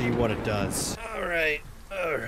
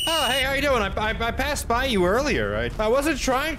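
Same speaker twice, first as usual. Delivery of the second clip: high, loud awkward hello; nervous mock greeting; 11 semitones higher, 5 dB louder than usual. Gamer meeting the monster again, high nervous hello.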